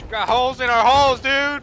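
yelling. Real, not acted. Ship flooding, panicked yelling.

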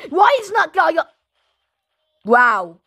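ordinary talk

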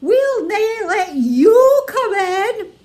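high, slow, loud piggy refusal; high defiant pigs; defiant little pigs. A narrator's reading. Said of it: "said the two little Grumpies".

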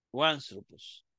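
"Uanthropos" as a single word, starting "wan-"; fuse the article into "Uanthropos" with a w glide; "Uanthropos" run together without a break; read as one word. Shown as a demonstration. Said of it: "Entonces se lee".

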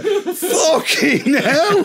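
drawn-out, high, laughing curse; laughing exclamation. Retold or imitated. Storyteller after the crowd mocks him, slow laughing high exclamation. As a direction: stretch it out, laughing in disbelief. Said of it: "I'm going".

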